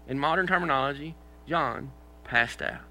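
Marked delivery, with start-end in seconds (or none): 1.5-2.8 John passed out said very slowly; drawn-out, deadpan delivery; slow, weighty punchline. Shown as a demonstration. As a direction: stretch each word for effect. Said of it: "deliberate slow repeat".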